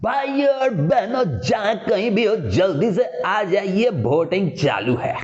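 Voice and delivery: drawn-out mock loudspeaker call; full-throated mic announcement. Shown as a demonstration. Announcer summoning villagers to vote over a mic, mock shouting. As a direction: stretch it out like a village election announcer. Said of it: "पूरा गर्दन फाड़ के चिल्ला देते हैं".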